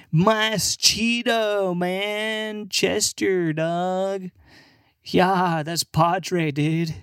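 high, drawn-out mock bro voice; drunk American bro. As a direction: ham up a tipsy bro showing off bad Spanish. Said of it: "get drunk".

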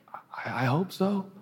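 a hint of a shy small voice rising high; softly unsure, hoping; timid hopeful reply. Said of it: "The initial posture is like".

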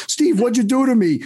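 high, loud, bewildered Chicago guy; lightly voiced Stu. Client calling his coach, high and loud in mock dismay. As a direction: play the baffled client on the phone.

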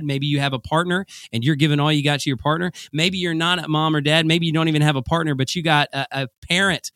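ordinary talk, nothing marked

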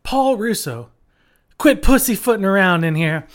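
mock-angry teacher reprimand; mad teacher. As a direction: imitate an exasperated teacher telling off a student. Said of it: "she clearly was very mad at me".

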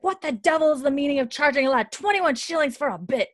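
mock outraged yell, pitched high; high-pitched, half-shouted demand; retold angry yelling. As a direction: act out an indignant telling-off. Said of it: "he's yelling".

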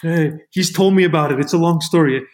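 high, loud rapid-fire yeahs; imitating an excited, impatient wave-away of a question; hurried brush-off. Husband brushing off his wife's question, hurried brush-off.

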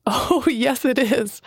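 laughing through the words; can't hold the laugh in. Acted. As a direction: still chuckling as she answers. Messenger laughing at Alana.

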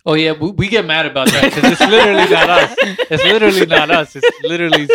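laughing through the words; loud amused laugh while talking. Real, not acted. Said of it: "That's fucking hilarious".

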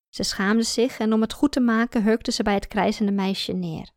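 ordinary delivery, nothing marked

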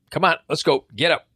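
put-on high, brisk let's go from an old man; impatient old man. Old customer rushes the young salesman, brisk and high. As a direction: hurry the kid along, pitched high.

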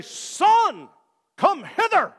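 put-on loud and high, old-fashioned "come hither" to a child; mock archaic scolding. Preacher voicing a parent calling a misbehaving son, mock archaic. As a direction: call a misbehaving son in stiff King James English. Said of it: "Aren't you glad that we don't talk like that now".

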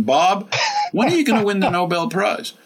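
playful imitation of people pestering; tongue in cheek. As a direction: tease the nagging question back at them. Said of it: "kind of tongue in cheek".

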